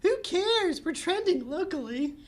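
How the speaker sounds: put-on very high, bright girly voice; squeaky cheerful sister; Bubbles voice. Bubbles voiced high in table read.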